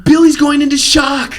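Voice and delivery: high, loud panicked movie voice; hammed-up panic over the radio; frantic movie quote. Quoting the movie to a friend, in a high, loud panicked voice.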